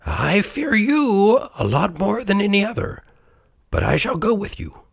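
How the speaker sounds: high, fearful character voice; Scrooge. A narrator's reading. Old miser admits fear to the phantom, high anxious voice. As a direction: plead high, without raising the volume. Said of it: "cried Scrooge".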